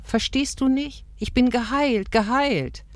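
high, urgent insistence; pitched well up, repeating it emphatically; insistent cry. A narrator's reading. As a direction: cry it out to someone who won't understand. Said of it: "rief Rahel".

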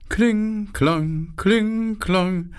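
high chiming bell voice, sing-song; tinkling flower-bell chant; hyacinth bells ringing. A narrator's reading. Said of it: "läuteten die Hyazinthenglocken".

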